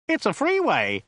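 high-pitched protest. A narrator's reading. Driver objecting to reversing on a freeway, high protest. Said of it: "protested Joe".

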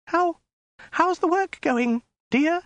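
very high, halting woman's voice; miserable yelp. A narrator's reading. Captive woman forced to greet Khan, miserable yelp. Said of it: "she yelped miserably".

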